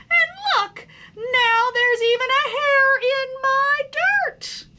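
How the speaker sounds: high, loud, whining tantrum of a fed-up little worm; whiny worm outburst. Acted. Son complains to his parents at dinner, loud whiny tantrum. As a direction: wail it like a sulking child who hates everything. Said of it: "exhausted by his outburst".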